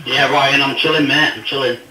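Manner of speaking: ordinary talk, nothing marked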